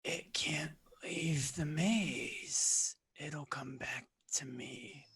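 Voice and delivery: rasping, wheezing creature. Acted. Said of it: "rasping, wheezing voice".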